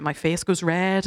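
usual speaking voice

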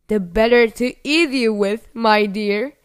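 loud, menacing storybook roar; roaring wolf. A narrator's reading. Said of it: "roared the wolf".

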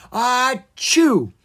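high, loud fiery sneeze. Acted. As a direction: blast out a giant sneeze. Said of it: "he sneezed a blaze".

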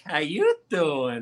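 slow, drawn-out come-on; flirting greeting. Shown as a demonstration. Guy greeting a hot chick, slow flirty drawl. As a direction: stretch it out like eyeing someone attractive. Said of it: "You see a hot chick?".

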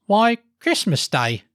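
high, slow, obvious answer; boy's voice. A narrator's reading. Boy telling Scrooge the day, wondering. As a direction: spell it out as a surprised lad. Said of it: "replied the boy".

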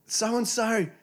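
high mock mum calling out. The kid's mum calls him to lunch, high calling voice. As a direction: call the kid to lunch like his mum.

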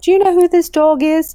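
put-on friend's showy British accent, laying it on; terrible British accent. Friend shows off the dog on a London train, in a bad British accent. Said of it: "that's a terrible accent".

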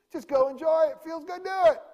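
high, loud reckless cheer; mock carefree shout. Preacher mimicking a carefree crowd, high shouting. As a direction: egg them on like a party crowd. Mocking licentious attitude.

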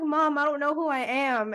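replaying her tearful confession; retold distress. Daughter tells mom through tears she doesn't know herself. Retelling crying call to mom.

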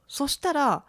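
usual speaking voice

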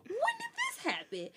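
can't believe what you're hearing; stunned disbelief. Retold or imitated. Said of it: "I was like".

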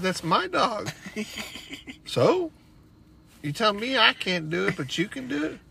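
high, loud, hammed-up indignation; acted quarrel. Son and dad arguing over the dog, acted quarrel. Retold quarrel, voicing both sides.

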